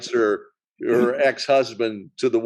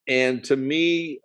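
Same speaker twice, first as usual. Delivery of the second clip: ordinary talk, nothing marked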